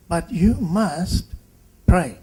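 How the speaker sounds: slow "you must", then a loud hit on "pray"; "pray" said loud and final; stressed pray. Retold or imitated. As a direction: drive home the word "pray" to the child. Doctor urges child to pray.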